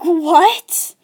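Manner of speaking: loud questioning yelp, imitating a listener; mock shock. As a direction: play the stunned friend with a sharp rise. Acts the shocked listener's reaction.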